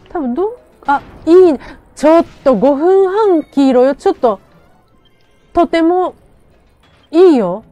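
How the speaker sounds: loud and thrilled, voice up high; cheering as the win gets close; excited. Real, not acted. Excited as the fight turns.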